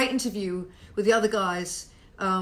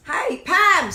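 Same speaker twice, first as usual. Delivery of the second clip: loud, high holler; yelling next door; 8 semitones higher, 7 dB louder than usual. Real, not acted. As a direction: scream into the office in the next building. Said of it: "screaming into the office in the next building".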